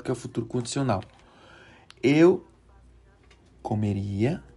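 ordinary talk

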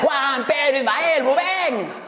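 drawn-out call. Retold or imitated. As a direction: stretch each name, calling for help. Calls lifeguards again, drawn out. (llamado lento y fuerte entre risas)

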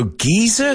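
"geyser" as GEE-zuh, lifted questioningly; British pronunciation. Retold or imitated. Teacher comparing British and American versions, British pronunciation. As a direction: say "geyser" with a long ee, no r. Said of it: "Oh, British pronunciation".

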